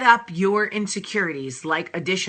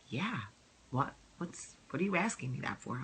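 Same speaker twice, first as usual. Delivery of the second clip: low, soft, offhand husband reply; husband's low voice; 6 semitones lower, 13 dB quieter than usual. Retold or imitated. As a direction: voice dropped lower and much softer for the husband. Voicing husband Michael's reply.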